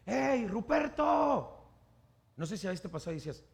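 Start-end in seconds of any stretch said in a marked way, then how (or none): 0.0-1.5 high, long-drawn holler of a name; distant calling shout. Acted. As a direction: call someone from far off. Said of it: "alguien te grita, no sé, a lo lejos".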